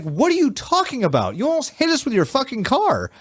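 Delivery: high, incredulous mock yell; retold angry retort. Recounting yelling at the reckless driver, incredulous mock yell. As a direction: fire back at the driver in disbelief. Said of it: "And I'm just like".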